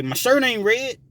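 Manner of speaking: taunting dare. Retold or imitated. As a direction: throw it out as a challenge. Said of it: "typically people say".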